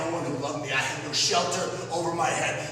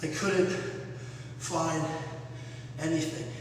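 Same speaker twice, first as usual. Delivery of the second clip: softer, halting, word by word with pauses; broken quiet echo; 3 semitones lower, 6 dB quieter than usual. Acted. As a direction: let it die away in pieces. Broken echo after the rant.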